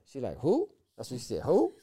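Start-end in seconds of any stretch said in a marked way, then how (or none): 0.4-0.7 high, sharply rising "who"; a high-pitched, challenging who; Chicago attitude. Retold or imitated. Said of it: "with that Chicago attitude". 1.4-1.7 high-pitched sassy comeback, swooping up; Chicago attitude. Retold or imitated. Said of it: "with that Chicago attitude".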